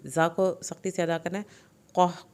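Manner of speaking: ordinary talk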